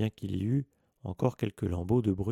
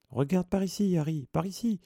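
much higher, eager little voice; small high voice; 9 semitones higher than usual. A narrator's reading. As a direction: call out in a small high-pitched voice. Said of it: "d'une petite voix aiguë".

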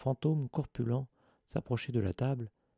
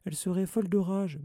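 high, voiced urgent plea; hurried, anxious murmur; 10 semitones higher than usual. A narrator's reading. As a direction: plead in alarm, pitched well up. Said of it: "murmura précipitamment Hermione".

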